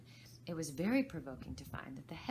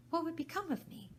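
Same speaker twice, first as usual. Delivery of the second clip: anxious; 12 semitones higher, 3 dB louder than usual. Acted. Alice's anxious thought.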